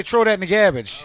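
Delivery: loud, high demo, "garbage" as GAB-idge; garbled garbage. Shown as a demonstration. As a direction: coach it loudly with no r in "garbage". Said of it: "what you gotta say".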